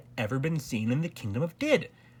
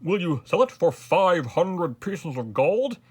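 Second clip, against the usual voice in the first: loud, high, slow offer; eager king; 5 semitones higher, 6 dB louder than usual. A narrator's reading. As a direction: make a grand offer, weighing each word. King eagerly offers gold for hat.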